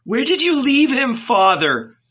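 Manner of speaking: high-pitched, urgent demand for an answer; urgent question. A narrator's reading. Asking the father where he left him, urgent. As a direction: ask it high and pressing.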